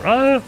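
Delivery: fake scream.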